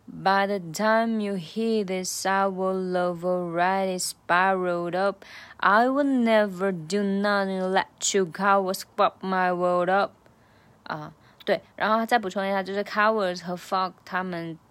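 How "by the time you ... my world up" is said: slow, careful rap of the whole lyric; slow version. Shown as a demonstration. Tutor closes with one more slow run for learners. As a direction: take each word at half speed. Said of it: "最后再来一个慢速版".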